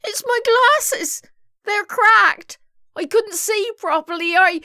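high, loud whimpered excuse; whimpering. A narrator's reading. Violet blames her cracked glasses, whimpering. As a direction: whine an excuse, pitched very high. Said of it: "whimpered Violet".

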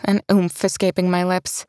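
ordinary delivery, nothing marked

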